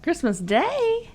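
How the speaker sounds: high, slow surprised question; drawn-out disbelief. Real, not acted. Hears release date.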